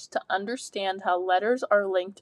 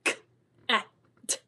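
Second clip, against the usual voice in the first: sounding out words; faster than usual. Shown as a demonstration. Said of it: "Blend the sounds in".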